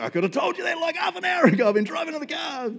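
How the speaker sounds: high, loud indignant protest; mock outrage; exasperated. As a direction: tell them you knew long ago. Exasperated reply to doctors.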